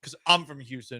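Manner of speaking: ordinary talk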